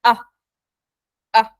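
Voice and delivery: relaxed short "ah" schwa; schwa sound. Shown as a demonstration. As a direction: say "ah" loose and lazy, like the schwa. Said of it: "repeat with me after me".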